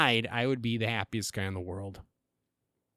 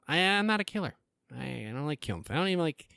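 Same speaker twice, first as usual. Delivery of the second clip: ordinary talk, nothing marked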